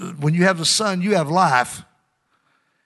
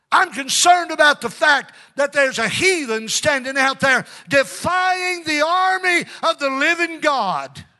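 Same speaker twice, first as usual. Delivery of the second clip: defiant shout; 11 semitones higher than usual. Acted. David shouting his cause to the soldiers, building to a shout. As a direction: rise to a battle cry.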